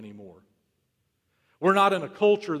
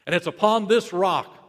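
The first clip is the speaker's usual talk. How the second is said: rising declaration; 7 dB louder than usual. Real, not acted. Climactic repeat of the promise.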